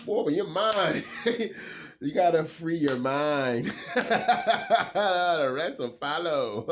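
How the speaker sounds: high, loud, laughing preachy voice; laughing mock guru. Reading cards, laughing at himself like a guru. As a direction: laugh through a playful sermon to yourself.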